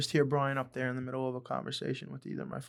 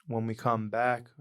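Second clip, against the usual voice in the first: ordinary delivery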